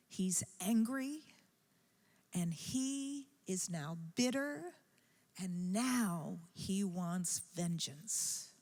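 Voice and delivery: normal talking voice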